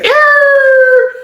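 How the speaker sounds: loud high sustained squeal; shrieking buzzer made by voice; imitated alarm sound. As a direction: hold a piercing high note. Imitated noise in the story.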